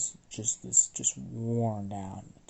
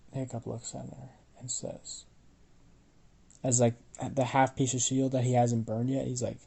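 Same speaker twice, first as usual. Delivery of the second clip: ordinary delivery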